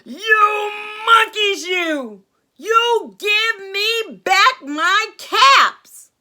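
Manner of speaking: high, loud, drawn-out storybook shout; angry peddler. A narrator's reading. Peddler shaking both hands at monkeys, angry shouting. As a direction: holler it slowly, hammed-up outrage. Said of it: "This made the peddler angry".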